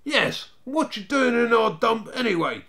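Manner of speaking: put-on high, loud little-boy challenge; fierce high-pitched demand; piping, fierce. A narrator's reading. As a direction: pipe up fiercely as the smallest gang member. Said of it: "piped the youngest fiercely".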